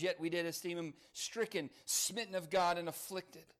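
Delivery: loud, high, fervent scripture reading; rising preacher's cadence, building to a peak; preaching scripture. Acted. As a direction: proclaim it to the whole church. Preaches Isaiah at full force.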